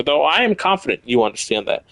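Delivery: ordinary delivery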